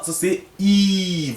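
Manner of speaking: "I" held long, slow and high like a teacher; drawn-out vowel demo. Shown as a demonstration. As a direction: stretch the vowel "I" slowly for the pupils. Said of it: "Alors, ça, c'est quoi?".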